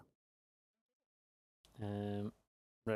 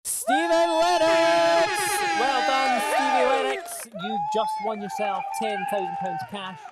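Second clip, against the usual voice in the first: very high, loud winner announcement; excited shout; 10 dB louder, slower than usual. Real, not acted. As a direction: shout the winner's name with joy.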